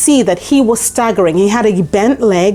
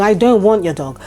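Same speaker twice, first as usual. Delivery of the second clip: ordinary talk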